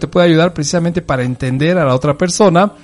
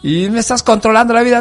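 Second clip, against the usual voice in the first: higher, quick whiny complaint; mock teen protest; 8 semitones higher, faster than usual. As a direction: rush it out like a sulking teenager. Teen son talking back to mom.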